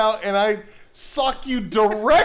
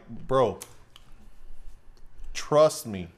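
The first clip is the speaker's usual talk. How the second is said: low, deliberate persuasion with long pauses; coaxing friend; 6 semitones lower, 4 dB quieter, slower than usual. Retold or imitated. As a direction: imitate a buddy calmly egging you on. Voices friend coaxing him.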